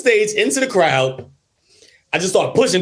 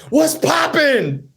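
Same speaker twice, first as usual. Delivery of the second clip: mock tough challenge; 6 semitones higher, much slower than usual. Retold attacker challenging him.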